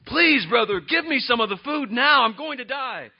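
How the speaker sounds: loud, high, frantic plea; hammed-up starving desperation; overdramatic begging. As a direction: beg as if about to die. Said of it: "he overreacts in his passions".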